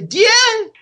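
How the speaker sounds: light mock Nicaraguan "Diez?", with the S swallowed; Nicaraguan Spanish accent. Teller shows how Nicaraguans say ten, in a light Nicaraguan Spanish accent. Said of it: "They don't use the S at the end".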